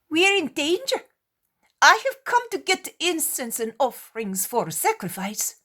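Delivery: high, loud panicked sailor; frightened sailor. Acted. Sailor bursting in on the disciples, loud and panicked.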